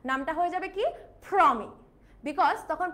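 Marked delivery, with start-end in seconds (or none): 1.3-1.7 louder প্রমি with an English aspirated "phr"; British/American-style প্রমি, puff on the p; British or American accent. Shown as a demonstration. Teacher says own name in British or American accent, প্রমি as "Phromi". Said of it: "British accent বা American অ্যাকসেন্টে".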